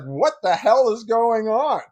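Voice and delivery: husband loses patience; exasperated shout. Retold or imitated. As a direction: drag it out in disbelief.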